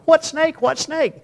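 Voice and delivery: high, frantic repeated question; squeaky alarm of scared children; panicked kids. Retold or imitated. Kids panic over a snake.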